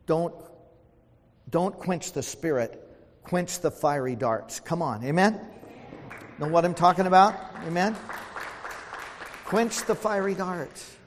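fervent sermon appeal; preaching exhortation. Real, not acted. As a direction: press it home to the church.

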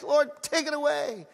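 acted begging; begging plea. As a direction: plead with God to lift the burden. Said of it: "he begged".

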